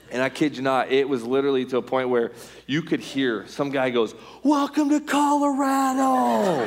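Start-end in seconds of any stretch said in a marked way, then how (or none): 4.4-6.6 high, long-drawn stadium holler; mock fan's high yell; imitated heckler yell. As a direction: holler it slowly to the whole ballpark. Imitates fan yelling from stands.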